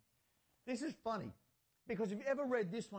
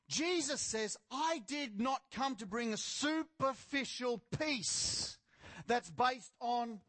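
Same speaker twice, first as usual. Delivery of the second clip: slow, weighty pulpit proclamation; emphatic preaching; 4 semitones higher, 4 dB louder, much slower than usual. Real, not acted. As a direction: hammer out the quote deliberately. Preaching at full force.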